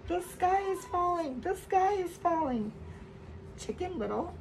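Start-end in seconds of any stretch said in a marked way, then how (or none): 0.1-2.7 higher panicked call; mild frightened cry. Acted. Friends crying out in fright as they run. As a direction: cry it out running scared. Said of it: "screamed as they ran".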